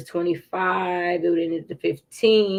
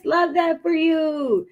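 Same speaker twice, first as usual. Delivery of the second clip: delighted praise; 9 semitones higher, slower than usual. Real, not acted. Cheering a viewer's news.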